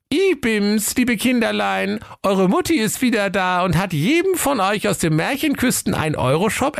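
high falsetto mother impersonation; chalk-softened fake mummy. A narrator's reading. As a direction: pose as the goatlings' mum, pitched high. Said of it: "Kreide ... aß es".

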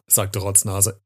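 ordinary delivery

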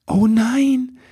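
high, embarrassed cry; dismayed elf. A narrator's reading. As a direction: cry it out, hand to forehead. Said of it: "rief Ribbelwitsch und schlug sich".

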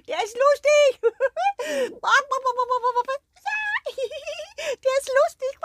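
high, loud clucking laughter; cackling hens. Acted. The hens burst out cackling at the dog's joke.

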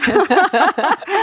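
bright burst of laughter; high laugh. Real, not acted. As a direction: laugh out loud, rising. Guest jokes about being invited.

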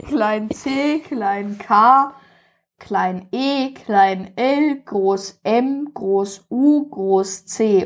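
letter by letter, slow and careful; spelling it out. Shown as a demonstration. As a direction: spell each letter deliberately. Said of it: "ich buchstabiere mal".

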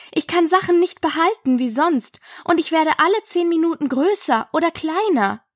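high, earnest Alice; troubled Alice. Acted. Voices Alice in narrated story.

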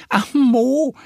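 very high duck voice patiently correcting her friend; Annie explaining. A narrator's reading. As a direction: set him straight kindly as the little duck. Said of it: "erklärt Annie". (hohe Entenstimme, geduldig erklärend)

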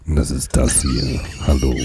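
deep, slow trailer announcer; movie trailer voice. Shown as a demonstration. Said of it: "Ich zeig's dir kurz".

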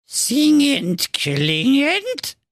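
very high, puzzled dwarf voice. Acted. Dwarf questions Marco's odd wish, high. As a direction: ask it back in disbelief. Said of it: "fragte der Zwerg".

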